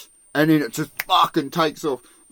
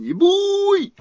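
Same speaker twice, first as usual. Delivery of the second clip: high, loud cheer; excited whoop; 6 dB louder than usual. Real, not acted. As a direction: whoop it out, thrilled. Excited whoop about spear gun.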